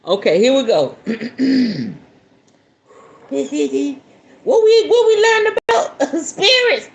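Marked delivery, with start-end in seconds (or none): 4.4-6.9 high, loud breaking laugh through the words; words swallowed by a real laugh; laughing fit mid-sentence; laughing hard. Real, not acted. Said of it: "Oh, I needed that laugh".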